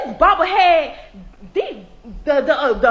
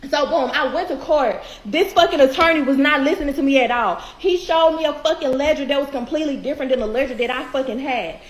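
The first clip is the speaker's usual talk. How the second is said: ordinary delivery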